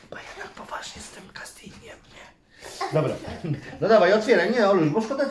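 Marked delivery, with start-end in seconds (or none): 0.0-2.4 whispered aside. Real, not acted. Parent whispering an aside to the viewers. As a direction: lean in and whisper it.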